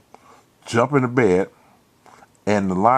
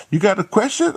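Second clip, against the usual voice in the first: Martha, higher voice; 12 semitones higher than usual. Retold or imitated. Martha voiced in retold story.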